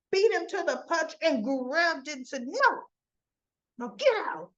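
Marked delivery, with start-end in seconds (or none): 2.5-4.5 high, loud no, no, get out; snatch it back and order him out; sharp no. Retold or imitated. Trina grabbing the gift from Cyrus, loud high refusal. Trina grabs the doves first.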